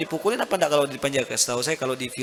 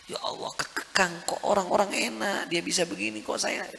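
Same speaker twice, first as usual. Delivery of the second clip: a partly whispered, higher grumble; a hint of a self-pitying believer; whiny complaint; 6 semitones higher, 4 dB quieter than usual. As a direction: moan about feeling caged by the rules. Complaining believer voiced in sermon.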